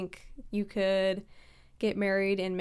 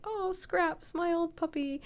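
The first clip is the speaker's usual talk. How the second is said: high character voice; 10 semitones higher, slower than usual. Retold or imitated. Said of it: "have him be like".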